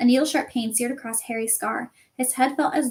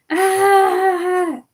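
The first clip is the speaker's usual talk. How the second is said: high loud scream of pain; 8 semitones higher, 7 dB louder than usual. Acted. Quirrell burned by Harry's touch, screaming. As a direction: cry out as your face burns.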